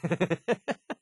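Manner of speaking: high little chuckle; amused laugh. Acted. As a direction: chuckle at a silly pirate act. Laughs after his pirate bit.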